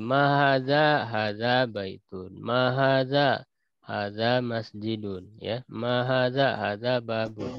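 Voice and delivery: slow, stretched Arabic question and answer; slow drill reading. Shown as a demonstration. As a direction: model each pair slowly for the class. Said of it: "tinggal disambungkan saja".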